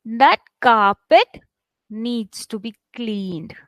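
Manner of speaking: teacher's drawn-out model with "carpet" lifted; stress demo. Shown as a demonstration. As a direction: lean on "carpet", pause, then let "needs to be cleaned" fall. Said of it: "let me read it for you".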